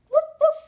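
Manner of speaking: high, loud pretend woofs; imitated dog barking; puppy bark. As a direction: yap like an eager little dog.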